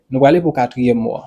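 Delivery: usual speaking voice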